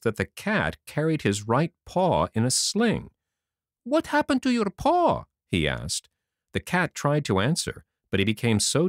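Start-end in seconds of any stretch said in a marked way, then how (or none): 3.8-5.3 put-on higher, bright young puppet boy; boyish Pinocchio voice. A narrator's reading. To the fairy, Pinocchio speaks in a high boyish voice. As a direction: play the eager wooden boy, much higher.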